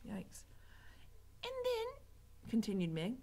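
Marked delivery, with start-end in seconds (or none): 1.4-2.0 put-on high-pitched girlish voice; eager serving girl. A narrator's reading. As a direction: carry on in a high voice. Mig continues eagerly.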